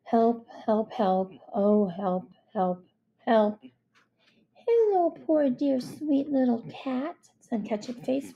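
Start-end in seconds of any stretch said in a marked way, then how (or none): 0.0-3.6 bored, toneless string of pleas; listless monotone; unenthusiastic. Acted. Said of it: "without much enthusiasm". 4.6-7.2 high, slow, sugary greeting; cooing sympathy. Acted. Girl coos over stranded cat.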